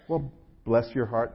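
slow Southern bless your heart, drawn out; slow, sweet Southern put-down. Shown as a demonstration. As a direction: drawl it like a Southern lady. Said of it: "I know how to say that in Southern".

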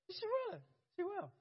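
she will squeaked way up high; amused high squeal. Real, not acted. Teasing his wife to the congregation in a high squeal.

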